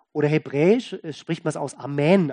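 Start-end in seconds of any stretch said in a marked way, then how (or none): 1.7-2.3 Amen with the weight on -men; second-syllable stress. Shown as a demonstration. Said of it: "also die Betonung auf die zweite Silbe".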